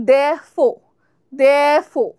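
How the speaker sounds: Therefore said "dh-eh-fo", r dropped; therefore demo. Shown as a demonstration. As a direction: swallow the r in Therefore, "defo". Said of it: "ইটাকে আমরা গিলে ফেলবো".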